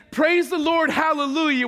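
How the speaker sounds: loud, high mock hallelujah; mock praise. Preacher mocking the Israelites praising God for manna, loud. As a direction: ham up their easy praise. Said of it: "you had no problem saying".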